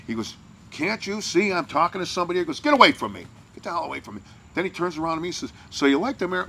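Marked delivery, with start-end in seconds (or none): 0.7-4.2 raised-pitch retold angry outburst. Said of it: "And he flipped out". 5.7-6.4 higher, chirpy switch after an outburst. Retold or imitated. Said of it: "like nothing happened".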